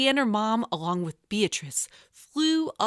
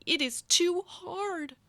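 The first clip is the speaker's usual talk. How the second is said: a touch of whining; 10 semitones higher, 4 dB quieter than usual. Acted. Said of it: "said Lottie".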